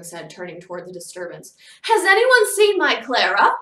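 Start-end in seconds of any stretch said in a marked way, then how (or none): 1.8-3.5 high, loud hysterical mother; desperate, panicked shout; frantic cry. A narrator's reading. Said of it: "Missus Brahms cried frantically".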